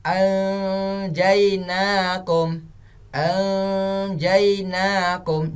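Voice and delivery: slow chant, blurred nasal opening "anjainakum"; veiled ikhfa recitation. Shown as a demonstration. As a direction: hide the n of "anjainakum" in a held hum. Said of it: "disamar-samarkan".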